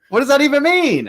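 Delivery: very high, loud squeal of a question; laughing disbelief. Real, not acted. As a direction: squeal it in amused bafflement. Laughing retort to co-host's teasing.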